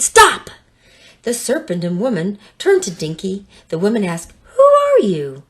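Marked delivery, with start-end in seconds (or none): 0.0-0.5 sharp loud cry of stop; shout. Acted. Dinky stopping the woman, loud shout. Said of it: "when Dinky shouted". 4.5-5.4 woman's voice. Acted. Woman voiced, startled question.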